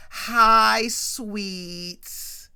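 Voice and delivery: big, loud, drawn-out greeting; signature greeting. Retold or imitated. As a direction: belt out a hammed-up catchphrase hello. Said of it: "hits him with the signature".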